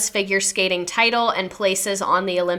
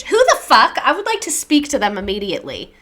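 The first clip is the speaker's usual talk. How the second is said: high, loud indignant outburst; sudden loud indignant cry; outraged outburst; 3 semitones higher, 4 dB louder than usual. Real, not acted. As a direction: burst out in shocked outrage, much higher and louder. Outraged at the attackers.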